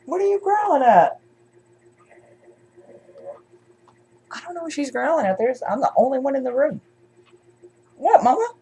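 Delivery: high, soft pet-directed voice; talking to pet. Real, not acted. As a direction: coo a puzzled question up high to the dog. Aside to a growling dog.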